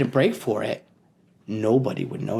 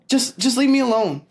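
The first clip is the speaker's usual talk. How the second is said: higher, stuttering plea; pleading brush-off; 10 semitones higher, 3 dB louder than usual. Acted. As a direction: push him away. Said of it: "Pete said".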